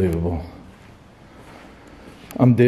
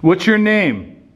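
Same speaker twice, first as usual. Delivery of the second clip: higher, challenging call into the dark; provoking the spirit; 7 semitones higher, slower than usual. Real, not acted. Ghost hunter challenging the spirit to give its name, raised taunting call. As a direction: taunt the presence to answer.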